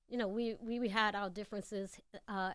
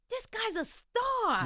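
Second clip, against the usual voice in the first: awed; 12 semitones higher, 3 dB louder, much slower than usual. Retold or imitated. First sees [name] dancing on stage.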